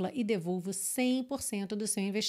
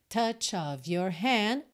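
slow demo reading; much slower than usual. Shown as a demonstration. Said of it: "o E de makes não é pronunciado".

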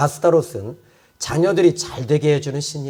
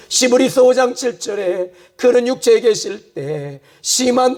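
higher, driving sermon about the weeping Lord; fervent preaching; 8 semitones higher than usual. Acted. Pastor urging the congregation, fervent preaching. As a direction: preach it with rising fervour.

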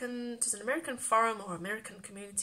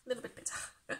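breathy whispered echo of the phrase; hushed whispered aside; whispered repeat; 9 dB quieter than usual. Shown as a demonstration. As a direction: whisper A little bit better to yourself.

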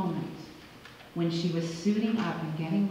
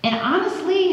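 normal talking voice

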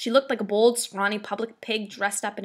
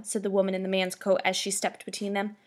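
ordinary delivery, nothing marked

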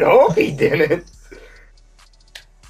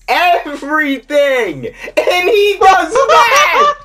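slow building disbelieving yell; raging shout; 8 semitones higher, 3 dB louder, much slower than usual. Real, not acted. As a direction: build to a laughing scream of rage. Gamer raging at missed shot.

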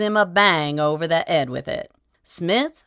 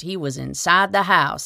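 usual speaking voice

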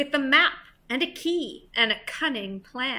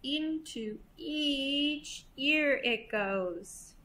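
drawn-out, softer delivery; slow, hushed aside; 7 dB quieter, much slower than usual. A narrator's reading. Reader traces the ladybird's pathway, slow and hushed. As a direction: stretch it out softly, word by word. Aside showing whispered idea's path.

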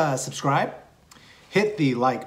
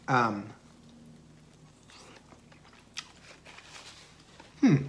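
talking between bites of food; muffled speech through chewing; mouth full, chewing; 5 dB quieter than usual. Real, not acted. Seen 3: takes bites and chews throughout with full cheeks while speaking.

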